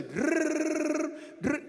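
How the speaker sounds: a high rolled drrrr. Shown as a demonstration. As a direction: rattle a rolled r. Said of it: "You just say".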